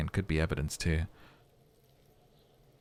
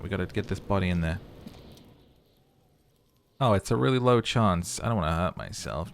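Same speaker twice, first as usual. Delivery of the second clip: ordinary talk, nothing marked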